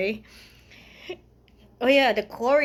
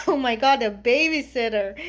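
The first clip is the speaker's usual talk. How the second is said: ordinary talk, nothing marked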